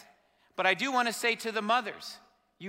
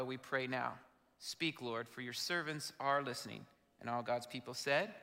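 low, soft congregational prayer; 4 semitones lower, 8 dB quieter than usual. Real, not acted. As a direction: lead them quietly and reverently in prayer.

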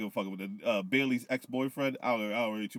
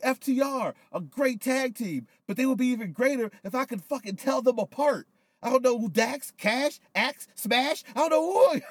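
high, laughing mock-heel promo voice; imitating Jericho; 12 semitones higher, 4 dB louder than usual. Retelling the Jericho promo to his friend in a high mocking voice. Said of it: "He was like".

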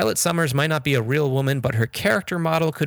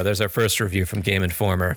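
normal talking voice

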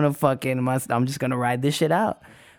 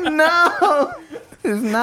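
laughing denial; 11 semitones higher, much slower than usual. Retold or imitated. Laughing while denying.

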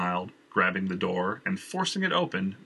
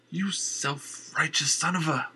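slow, tearful then angry; tears to anger; 7 semitones higher, slower than usual. Acted. Valerie insults Jenner, tears turning to anger. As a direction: spit the insult through tears. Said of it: "voice flipping from tears to anger".

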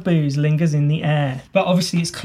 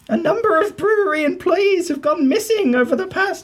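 put-on high, conspiratorial old lady voice; a prim busybody spreading dark rumours; gossiping old lady; 12 semitones higher than usual. Same old lady voice, laughing.

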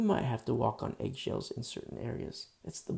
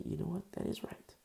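light, hushed reply pitched higher; soft higher answer; 5 semitones higher, 7 dB quieter than usual. Retold or imitated. A voice answering his prayer, soft and hushed. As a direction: answer gently as another voice. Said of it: "Sometimes they'll say".

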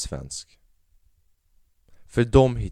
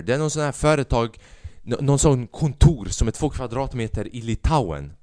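usual speaking voice

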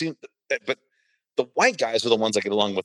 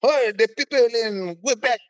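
much higher, slow, snooty Parisian voice; mocking Parisian; 11 semitones higher, slower than usual. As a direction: sneer at Quebec as a haughty Parisian, high and slow. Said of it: "And then in Paris are like".

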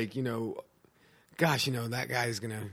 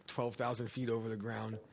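usual speaking voice

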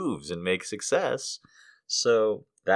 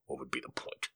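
much lower, softer booming deity voice; voice of God; 10 semitones lower, 19 dB quieter than usual. Acted. God refusing a prayer, deep and dismissive. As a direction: answer as an unbothered God. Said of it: "I didn't know what God's voice would sound like".

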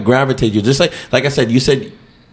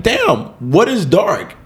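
high, slow puzzled; 7 semitones higher, much slower than usual. Retold or imitated. His retold question in session.

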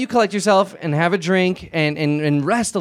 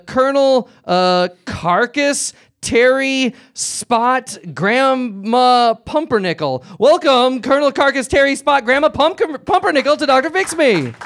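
game show announcer; 6 semitones higher, slower than usual. Acted. Host welcoming the next guests onto the stage, announcer style. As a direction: roll out each name like a host.

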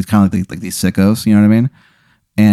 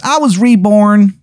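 very high-pitched cartoon character voice; Shithead Steve; 11 semitones higher, slower than usual. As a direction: squeal it out in a put-on falsetto. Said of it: "He goes".

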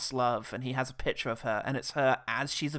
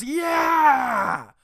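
much higher, louder yelp; startled yell; 13 semitones higher, 9 dB louder than usual. Retold or imitated. Nielsen surprised by his old friend, yelling. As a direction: jump and yell it. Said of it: "and goes".

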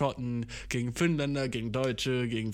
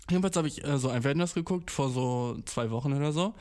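ordinary talk, nothing marked

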